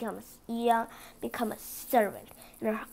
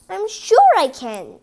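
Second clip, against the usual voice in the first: much louder, higher happy cry; eager cry; 6 semitones higher, 12 dB louder than usual. Acted. Cinderella eagerly promises her stepmother, loud and high. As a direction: burst out with eagerness. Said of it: "cried Cinderella".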